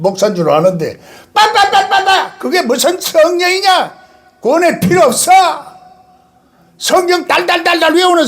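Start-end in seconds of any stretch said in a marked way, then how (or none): 1.3-2.4 mocking babble. Mocks tongues speaking. 2.4-8.3 mocking critics.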